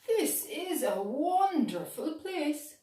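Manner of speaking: a little explorer marvelling aloud; Bingy's voice. A narrator's reading. Bingy tells Clegane, lightly voiced and delighted.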